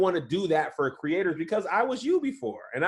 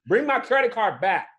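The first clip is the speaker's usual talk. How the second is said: calling out to son; 6 semitones higher than usual. Real, not acted. Dad telling his son to bring back his card, firm call.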